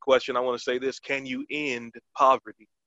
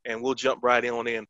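usual speaking voice

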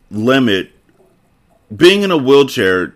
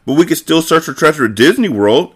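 higher-pitched, excited suggestion; exclaiming; 7 semitones higher than usual. A narrator's reading. Nikki suggests searching at Disney World, exclaiming. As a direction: pipe up with a new hope. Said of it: "exclaimed Nikki".

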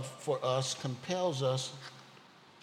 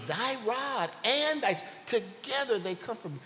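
excited realization; 8 semitones higher, 3 dB louder than usual. Acted. Preacher sharing his breakthrough with the congregation, excited. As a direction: let the discovery lift your voice.

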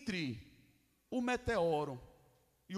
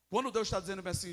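normal talking voice

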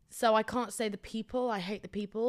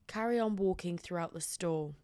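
normal talking voice

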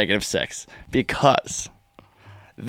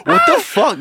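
shocked. Retold or imitated. Retelling his shocked reaction.

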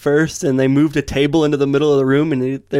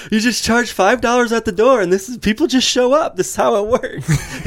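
high-pitched, lightly laughing imitation of explaining; mock explaining; 8 semitones higher than usual. Promoter explains door cover to nervous alley owner, mock high explaining.

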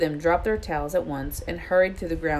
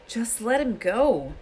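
high, drawn-out plea; urging; 6 semitones higher, slower than usual. A narrator's reading. Harry urges Hagrid to free the dragon, urging. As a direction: urge him, stretching the words. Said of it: "Harry urged".

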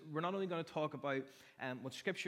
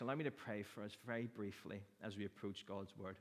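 usual speaking voice

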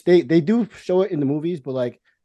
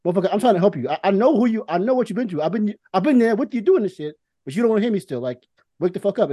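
higher, pleading and exasperated; a wife telling her husband to wake up, exasperated; 6 semitones higher than usual. Retold or imitated. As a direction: plead with him, fed up but caring. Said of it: "It's like".

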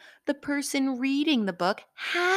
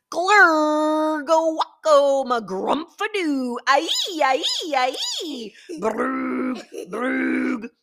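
loud, higher gibberish noises; silly sounds; 6 semitones higher, 5 dB louder than usual. Acted. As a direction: ham up nonsense noises. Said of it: "make silly sounds".

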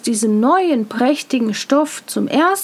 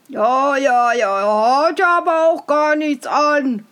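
crowd calling out; 5 dB louder than usual. Acted. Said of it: "rief schließlich das ganze Volk".

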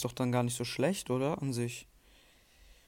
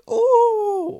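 high squeal; 11 dB louder than usual. Real, not acted. Sudden squeal at game.

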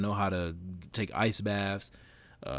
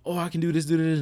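higher, louder burst of giddy self-belief; manic high; 9 semitones higher, 7 dB louder, faster than usual. Acted. Mocking a false burst of confidence, manic high. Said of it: "this little manic high of".

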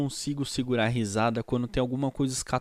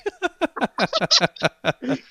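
high burst of laughter; loud laugh; 12 semitones higher, 4 dB louder than usual. Real, not acted. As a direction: let a big laugh out.

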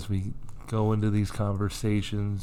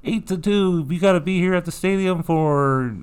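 higher, louder mock official notice; bossy announcement; 8 semitones higher, 3 dB louder than usual. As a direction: bark it like a pushy memo. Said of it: "They're like".

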